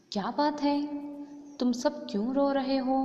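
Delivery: high, loud concerned queen; Kunti asking. A narrator's reading. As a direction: call out with worried concern. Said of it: "Kunti ಕೇಳಾಕತ್ತಾಳ".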